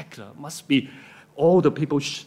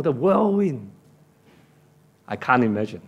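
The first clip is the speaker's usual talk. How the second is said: ordinary delivery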